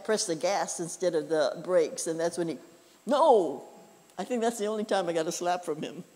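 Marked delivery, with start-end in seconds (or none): 3.1-3.6 sharp, louder cry of alarm; sudden shout. Retold or imitated. Dad at a driving lesson, sudden shout. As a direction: stop the student driver cold.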